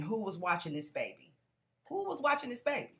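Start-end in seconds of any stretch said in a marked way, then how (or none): 1.9-2.9 mock scream. Paramedic demanding who watched the baby, higher mock yell. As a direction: repeat it higher, pitched up. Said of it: "ambulance people kept screaming".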